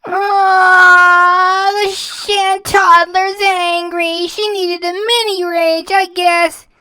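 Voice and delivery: high, slow mocking baby voice; high mock pity for a sulking child; mocking baby talk. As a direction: coo at her like a cranky toddler. Mocking her as angry toddler.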